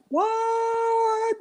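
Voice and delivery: a squeaky, very high astonished what; stunned what. Retold or imitated. Coach recalling the shock of beating his old team, very high and astonished. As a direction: shoot way up in disbelief. Shocked reaction to upset win.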